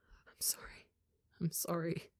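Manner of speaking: put-on boy sobbing apologies to his parents; sobbing whisper. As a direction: choke out the apology. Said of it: "he sobs against them".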